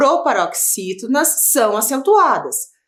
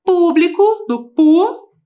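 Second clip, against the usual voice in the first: "público" pitched higher and louder, then "pu" punched; emphatic, higher; 7 semitones higher, 3 dB louder, much slower than usual. Shown as a demonstration. Teacher stressing the tonic syllable for students, higher and louder. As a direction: stress the "pu" of "público", high and loud. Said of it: "Pétala é no pé".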